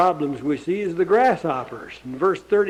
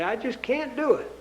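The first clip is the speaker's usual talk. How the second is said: voicing the quitter; 5 semitones higher, 6 dB quieter than usual. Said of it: "but they say".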